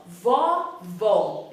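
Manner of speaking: VOVÓ drawn out with open "aw" in both syllables; low, emphatic open-O "vovó"; open vowel. Shown as a demonstration. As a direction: model "vovó" with wide open O sounds. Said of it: "o som da palavra vovó é aberto".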